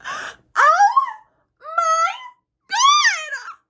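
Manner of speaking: high, loud fake sob; mock sobbing. Mock sob about a reaction she didn't have. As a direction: wail it like a hammed-up crier.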